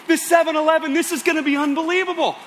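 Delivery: sharp gasp, then high, loud thrilled squeal; gasping delight. Acted. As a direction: gasp and shriek like an ecstatic kid. Kids ecstatic about 7-Eleven.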